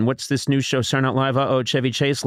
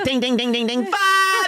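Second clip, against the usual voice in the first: high prissy dinging; 10 semitones higher than usual. Retold or imitated. Boy dings his cup to call his father. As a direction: ring for attention, childish. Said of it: "And I'm like".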